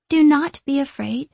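put-on high, lilting little-girl voice; childish lilt. A narrator's reading. Ghost girl reassuring a frightened visitor, with a childish lilt. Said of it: "with a childish lilt in her voice".

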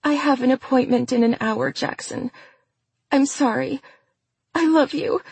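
high, pitiful woman's voice; pitiful mistress. A narrator's reading. Mistress telling her lover of the appointment, pitiful and high. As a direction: play the sorry victim, pitched high.

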